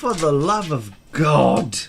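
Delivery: exasperated British sigh of a line; British accent. Pretend. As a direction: plead in put-on British. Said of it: "all gonna talk in a British accent".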